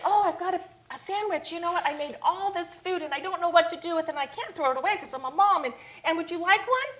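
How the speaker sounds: high, bubbly, fast ditzy mom voice; high chirpy scatterbrained mom, rushing her words; playing dumb blonde. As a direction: act the clueless sweet lady handing out food. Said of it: "I kinda play this dumb blonde thing".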